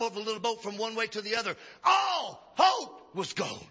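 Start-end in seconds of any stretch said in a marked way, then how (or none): 1.8-3.7 drawn-out, heavy storytelling; preacher dramatizing the storm; slow dramatic line. Acted. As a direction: let each word sink.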